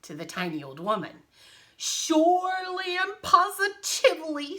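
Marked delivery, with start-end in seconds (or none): 1.8-4.6 high, loud, emphatic agreement; grandly overdone lion voice; lion character. Acted. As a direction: gush like an eager lion. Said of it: "said the lion".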